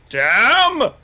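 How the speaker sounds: high, loud, drawn-out exclamation; mock amazement. Reader reacting to Caroline's jab, big impressed exclamation. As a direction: stretch it out like a heckler's big reaction.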